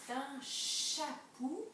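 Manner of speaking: "chapou" very slowly with a long "ch"; drawn-out first sound. Shown as a demonstration. Teacher helping pupils hear sounds in chapou, slowly stretched. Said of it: "bien, bien écouter".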